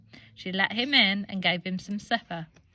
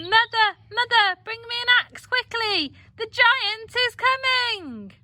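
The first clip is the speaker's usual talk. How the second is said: much higher, loud frantic cry; panicked shout; 12 semitones higher, 4 dB louder than usual. Acted. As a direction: scream for the axe in panic.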